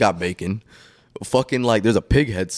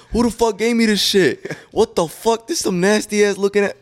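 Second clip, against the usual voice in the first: going off; 10 semitones higher than usual. Retold or imitated. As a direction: yell it like you're furious about the ham. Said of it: "like, just going off".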